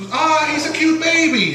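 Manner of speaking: high cooing aunt voice, fond and sweet; doting aunt. Retold or imitated. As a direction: coo over the baby like an aunt. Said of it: "my aunt would be, would see".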